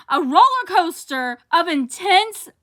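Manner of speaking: high and slow, the title shouted up top; ecstatic reveal. Real, not acted. Vlogger revealing her book of the year, overjoyed. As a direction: build to the title like a giddy fan.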